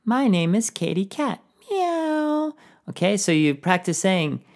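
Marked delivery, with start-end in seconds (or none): 0.0-2.5 high puppet cat voice; Katie Cat. Shown as a demonstration. Cat puppet introducing itself to pupils, high voice. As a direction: talk high as a cat puppet, ending in a meow. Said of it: "maybe a cat".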